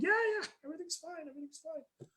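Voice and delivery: covering up. Retold or imitated. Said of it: "I'm like".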